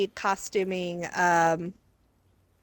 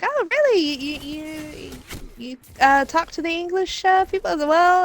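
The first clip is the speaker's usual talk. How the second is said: high, incredulous mock voice; mock surprise, high and breathless; 7 semitones higher than usual.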